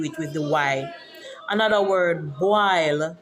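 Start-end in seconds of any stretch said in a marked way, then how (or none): 2.4-3.2 "boil" said as "bwile"; Patois pronunciation. Shown as a demonstration. Introducing another Patois word, "boil" as "bwile". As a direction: say "boil" with a w glide and long I. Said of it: "Boil is for boil".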